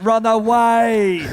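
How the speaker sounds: loud drawn-out yell; shouting. Real, not acted. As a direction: bellow it slowly, urging. Yelling at fleeing player.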